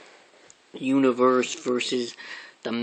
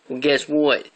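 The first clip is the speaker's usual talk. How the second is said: ordinary delivery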